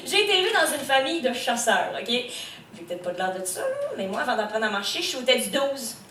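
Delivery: ordinary delivery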